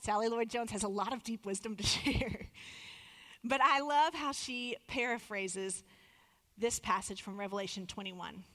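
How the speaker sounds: normal talking voice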